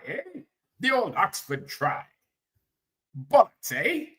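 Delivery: high, swooping caricature of British speech; put-on British accent, poking fun; mock British accent. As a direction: ham up a proper British fuddy-duddy, pitch jumping high. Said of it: "you know how the British do".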